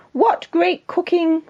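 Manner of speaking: high, drawn-out royal praise; delighted king. Acted. King praising the cook's meal, high delighted voice. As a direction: savour it with storybook delight.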